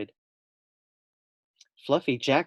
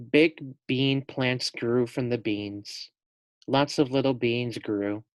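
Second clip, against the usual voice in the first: usual speaking voice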